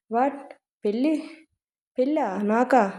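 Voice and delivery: barely able to believe the word marriage; shocked disbelief. A narrator's reading. Son stunned as his mother raises marriage, soft shocked questions.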